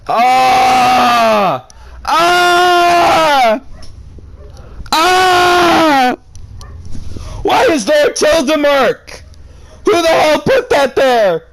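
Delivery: very high, loud outraged shrieks; shrieking in real fury at a tilde; outraged screaming. Real, not acted. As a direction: scream in disbelief at the text.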